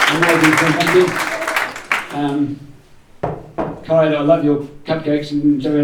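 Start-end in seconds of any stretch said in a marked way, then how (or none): 0.1-2.2 louder, higher burst of praise; excited praise. Real, not acted. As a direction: cheer him on across the room. Praising Finn's picnic entry.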